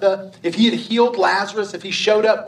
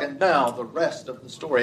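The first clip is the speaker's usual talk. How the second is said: lower, softer radio announcer voice; a smooth old-time radio sign-off; Paul Harvey; 5 semitones lower, 4 dB quieter than usual. Retold or imitated. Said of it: "his voice is just radio gold".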